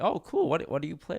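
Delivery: imitating an eager stranger, voice jumping high; mock eager stranger. Stranger at the party asks what games he plays, mock eager and high.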